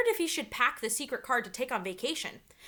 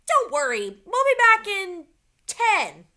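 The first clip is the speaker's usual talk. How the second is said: loud, bright reassuring call; calling back; 7 semitones higher, 10 dB louder, slower than usual. A narrator's reading. Annie calling back to Dad as she runs off, loud and reassuring. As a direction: call back over your shoulder. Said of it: "said Annie".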